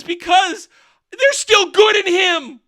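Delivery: high, drawn-out shout, hammed up; mock dramatic plea. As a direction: proclaim it like overblown melodrama. Mocks the film's dramatic plea.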